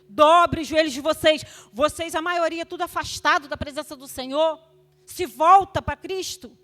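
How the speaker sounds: high, loud preaching command; rally them to their knees; fervent loud call. Retold or imitated. Grandmother urging the mothers to turn to Christ, fervent loud call.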